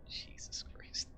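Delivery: breathless whispered laugh and curse. Real, not acted. GM laughing at the players' antics, whispered. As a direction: laugh it off under your breath. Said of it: "laughs then whispers".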